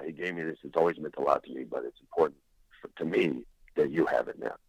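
lightly voiced older friend, low and earnest; hushed sincere friend. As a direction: hand over the gift quietly, with feeling. Friend's tender gift speech.

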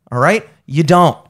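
ordinary delivery

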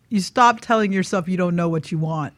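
usual speaking voice

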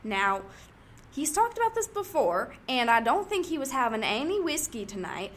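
ordinary delivery

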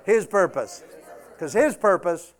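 full-force preacher calling out the scripture; preaching at full force. Real, not acted. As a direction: lift the house with it.

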